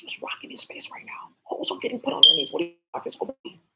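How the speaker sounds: soft unvoiced whispering, slow in places; hushed conspiratorial whisper. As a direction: whisper it like a secret in space. Whispered secret exchange.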